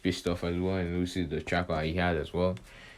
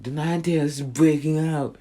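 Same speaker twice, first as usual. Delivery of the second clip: higher, louder alarmed cry from the onlookers; alarmed crowd; 7 semitones higher, 5 dB louder than usual. Retold or imitated. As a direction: sound the alarm like a panicked bystander. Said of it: "they're like".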